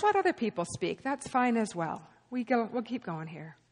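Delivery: ordinary delivery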